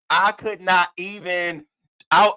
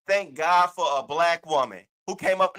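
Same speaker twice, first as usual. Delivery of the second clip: normal talking voice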